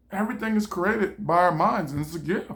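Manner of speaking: ordinary talk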